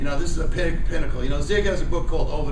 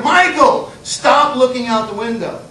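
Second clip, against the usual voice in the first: teacher scolding; 5 semitones higher, 5 dB louder than usual. Retold or imitated.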